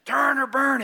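mock hellfire shout. Preacher imagining that message taken to young adults, shouting. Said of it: "if you took that message".

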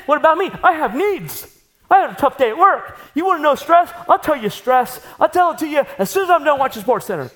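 a self-pitying husband demanding attention; whiny selfish husband. Pretend. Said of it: "instead of looking at her and saying".

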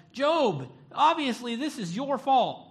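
high, loud mock accusation; hammed-up know-it-all friends blaming Job; accusing friends. Job's friends blame him for his suffering, loud and high.

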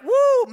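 high, loud whoop; crowd cheer. Retold or imitated. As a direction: cheer like an excited fan. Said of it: "And so they're like".

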